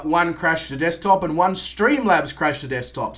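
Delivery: usual speaking voice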